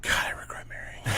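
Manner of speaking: fast, weary whisper. Retold or imitated. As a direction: breathe it out, fed up. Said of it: "my wife was just like".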